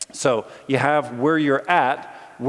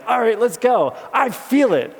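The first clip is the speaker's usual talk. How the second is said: mock pep; 9 semitones higher, 5 dB louder than usual. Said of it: "you're just like".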